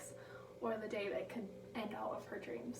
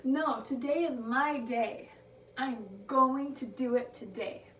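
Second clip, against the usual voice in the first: triumphant shout; 2 semitones higher, 8 dB louder, slower than usual. Retold or imitated. Skier declaring to the mountains, triumphant and loud. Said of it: "throws her hands up and says".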